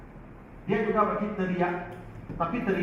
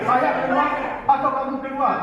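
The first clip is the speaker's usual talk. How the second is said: mock scolding teacher; 8 semitones higher, 4 dB louder than usual. Teacher voiced scolding his class.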